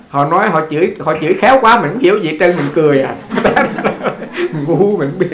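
loud, amused, breaking into laughs; real laughter spilling into words; talking through laughter. Real, not acted. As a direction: tell the joke loudly while cracking up. Joking, laughing at own joke.

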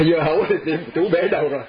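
punchline told through laughter; laughing. Real, not acted. Novice's punchline to his friend, laughing.

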